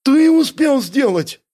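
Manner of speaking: high, loud outraged question; indignant. A narrator's reading. Бяка disputing who made the hideout, indignant. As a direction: throw it back in a high voice. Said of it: "тоже возмутился Бяка".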